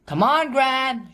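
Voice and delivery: high cheering shout. A narrator's reading. Biff urges Gran to win, high cheering shout. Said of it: "she shouted".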